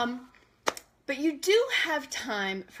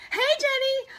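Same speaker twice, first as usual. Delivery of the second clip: high, louder hey; excited greeting; 14 semitones higher, 7 dB louder than usual. Real, not acted. Host greeting Jenny, high louder hey. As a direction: call out happily.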